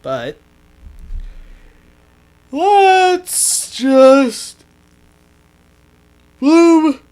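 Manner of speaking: yawning while talking; words stretched through a yawn. Real, not acted. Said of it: "Sorry about that yawn".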